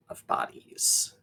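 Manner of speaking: hushed grim reveal; soft, whispered horror narration; ominous whisper. Acted. Game master reveals bodies ominously.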